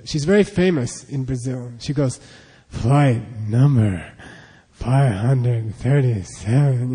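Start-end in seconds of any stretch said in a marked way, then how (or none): 2.7-6.9 low, very slow announcement voice; airport announcer. Retold or imitated. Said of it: "the lady who makes the announcements".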